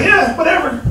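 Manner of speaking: put-on high, bored valley girl; dismissive airy valley girl. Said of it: "Sound like a valley girl".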